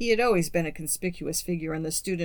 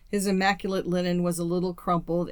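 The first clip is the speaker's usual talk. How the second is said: ordinary delivery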